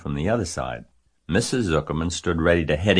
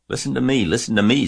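high, mildly raised cry; goose's mild scream; 7 semitones higher, 5 dB louder, faster than usual. A narrator's reading. Goose demands Wilbur's ear, mildly screaming. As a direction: cry for attention, short of a real scream. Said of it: "screamed the goose".

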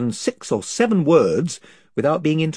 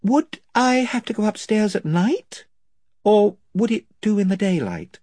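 a hint of a puzzled girl, higher; lightly voiced girl, confused; young girl Lucy; 10 semitones higher than usual. A narrator's reading. Lucy asks.

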